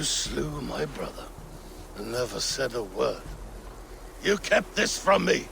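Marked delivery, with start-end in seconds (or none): 4.2-5.5 higher, pained accusation; angry accusation. Acted. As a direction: confront them for hiding it from you. Confronting a withheld death.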